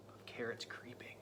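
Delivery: hushed, creeping whisper; spooky whisper. A narrator's reading.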